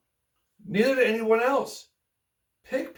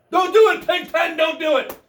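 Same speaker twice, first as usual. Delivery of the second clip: loud pleading cry, high and urgent; shouted warning; 8 semitones higher, 7 dB louder than usual. Acted. Narrator shouting a warning at Pigpen, loud and high. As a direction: yell at him not to do it.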